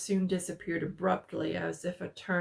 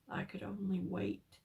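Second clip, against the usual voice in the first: ordinary delivery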